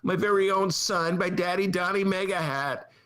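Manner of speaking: high, gushing mock amazement; sarcastic starstruck fan; mock awe. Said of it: "And I'm thinking".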